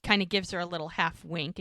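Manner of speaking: ordinary talk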